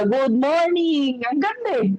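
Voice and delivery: high, drawn-out, bright "Good morning!"; cheerful greeting. Pretend. Speaker acts out waking up happy, sings out a slow high greeting. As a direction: stretch the greeting out happily on waking.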